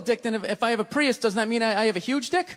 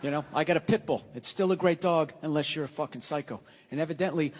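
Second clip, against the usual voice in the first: usual speaking voice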